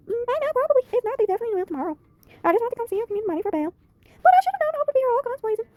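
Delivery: high, bright-toned put-on voice of a friend; Peyton voice. Friend rushes to the police station, high Peyton voice. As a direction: pitch way up to play Peyton fussing over her friend. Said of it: "I like to do her voice".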